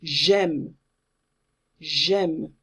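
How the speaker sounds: "J'aime" joined, no pause after the J; run as one word. Shown as a demonstration. Teacher models it for students, joined as one word. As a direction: say "J'aime" as one smooth syllable. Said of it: "as if it was one word".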